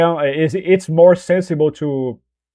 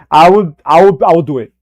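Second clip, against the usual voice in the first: ordinary delivery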